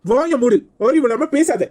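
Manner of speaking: heated protest; angry rebuke. Acted. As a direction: silence the accuser indignantly. Quarrel, silencing the accuser.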